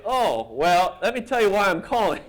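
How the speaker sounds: high, sheepish caller easing into bad news; retold nervous high-pitched explanation; awkward explaining. Cartoonist stalling on the phone to the colleague he mocked, awkward.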